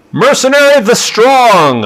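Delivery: high, loud drawn-out herald call; herald announcement. Retold or imitated. Herald announces Relop to the noble party, booming. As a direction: proclaim each name to the hall.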